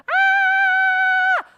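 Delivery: shrill high shriek, full force; piercing child's scream; terrified scream. Acted. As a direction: scream like a kid who saw a werewolf. Paula screaming while running.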